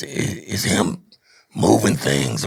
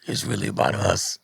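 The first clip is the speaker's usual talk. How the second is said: soft whispered repeat, lower; hushed whisper; 7 semitones lower, 3 dB quieter than usual. Real, not acted. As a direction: breathe it quietly for weight.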